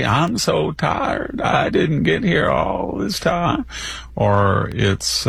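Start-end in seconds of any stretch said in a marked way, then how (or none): 0.0-3.7 slow, high mock drawl; Hillary Clinton. Pundit mocking a candidate pandering to her audience, slow high drawl. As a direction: drag it out in a mocking put-on drawl. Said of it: "or it's Hillary".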